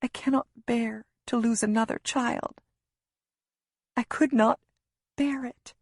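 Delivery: high, halting, close to tears; desperate mother barely holding together; anguished near-whisper. A narrator's reading. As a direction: plead in a high, strained voice with breaks. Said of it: "I whisper".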